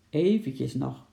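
ordinary delivery, nothing marked

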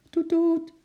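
high double honk; toeter nadoen. A narrator's reading. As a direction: imitate a car horn, short then held. Per ongeluk tegen de toeter. (hoog dubbel getoeter)

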